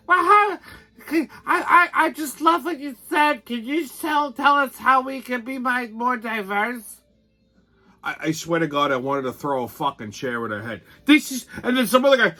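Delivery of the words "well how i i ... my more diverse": high, simpering mock voice of a gushing woman; mocking liberal woman. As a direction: mimic a gushing, eager do-gooder. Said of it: "this fucking fat animal".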